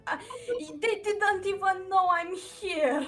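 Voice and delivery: loud, high exasperated scolding; angry in-character shout. Acted. Hidden character berating the ally who gave him away, shouting angrily. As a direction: yell at your ally for blowing your cover.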